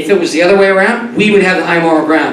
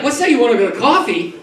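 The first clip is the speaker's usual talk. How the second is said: high, hammed-up prissy woman voice; mock coy woman; 6 semitones higher, slower than usual. Comic plays a woman refusing coffee, in a hammed-up high prissy voice. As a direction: play the offended lady, mock prim.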